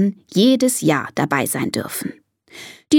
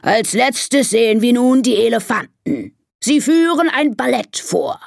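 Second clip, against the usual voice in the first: raised-pitch master of ceremonies; announcing lion; 4 semitones higher than usual. Acted. Lion introducing the elephants' act, announcer voice. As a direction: proclaim it to the crowd.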